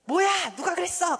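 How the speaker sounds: high, loud angry demand; acted outburst at the kids; scolding parent. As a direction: demand the culprit, voice shooting up. Parent demanding who made the mess.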